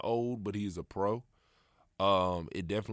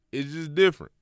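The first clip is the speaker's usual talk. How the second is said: "different" higher and louder; excited emphasis; 8 semitones higher, 10 dB louder than usual. Real, not acted. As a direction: hype it up: It's just different.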